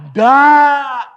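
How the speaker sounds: loud, high, drawn-out denial; hammed-up refusal; emphatic no. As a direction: belt out the no. Emphatic answer to own question.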